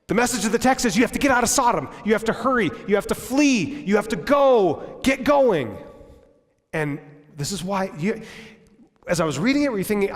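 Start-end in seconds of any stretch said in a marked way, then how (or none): 0.1-5.8 louder, higher preaching that builds to a shout; fiery call to flee; urgent preaching. Acted. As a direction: drive the command home. Preacher urging flight from Sodom.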